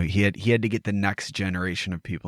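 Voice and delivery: ordinary delivery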